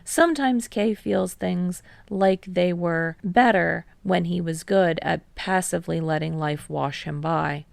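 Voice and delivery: ordinary delivery, nothing marked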